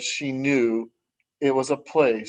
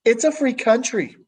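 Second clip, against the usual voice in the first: defiant retort; 9 semitones higher than usual. Acted. Carmela retorts to her brother.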